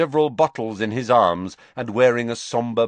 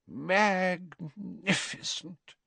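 slow, hushed, higher admiration; awed Slughorn; 10 semitones higher, 5 dB quieter, much slower than usual. A narrator's reading. Slughorn admiring the dead spider, slow and hushed. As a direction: savor the word in awe.

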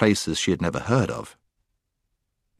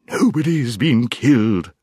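owl hooting; 4 semitones higher, 3 dB louder than usual. A narrator's reading. Owl denying any killing to the dwarf, hooting. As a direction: hoot the words out with long vowels. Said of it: "hooted the owl".

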